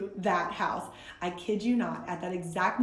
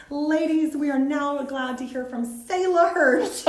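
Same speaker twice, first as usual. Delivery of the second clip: higher, louder voice introducing a speaker; hostess announcing; 5 semitones higher, 5 dB louder than usual. Retold or imitated. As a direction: announce the guest brightly to the room. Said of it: "beautiful hostess ... stands up and says".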